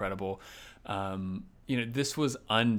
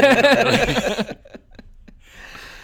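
loud laugh; 14 dB louder than usual. Real, not acted. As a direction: burst of high laughter. Teasing exchange about Mike.

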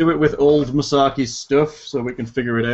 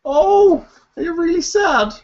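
high-pitched fussing; 5 dB louder, slower than usual. Real, not acted. Fussing over crying player.

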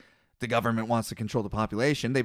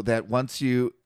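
ordinary talk, nothing marked

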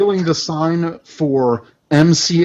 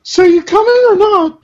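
put-on high girl voice; 4 dB louder than usual. Voicing teen character.

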